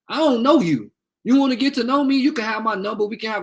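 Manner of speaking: real anger thrown at the troll; angry confrontation. Real, not acted. Mother confronting a troll on live, furious and high.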